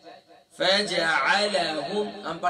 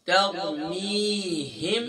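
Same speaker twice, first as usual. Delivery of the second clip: ordinary talk, nothing marked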